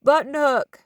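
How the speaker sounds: high, loud holler of a play call; little brother hollering a play call across the yard. A narrator's reading. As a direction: yell the play to your brothers. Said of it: "Eli hollered".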